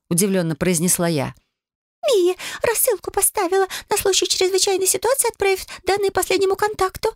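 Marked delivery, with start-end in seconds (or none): 2.1-7.1 high, tinny little mirror voice; magic mirror voice. A narrator's reading. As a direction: explain it like a tiny talking mirror. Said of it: "пояснила Матильда".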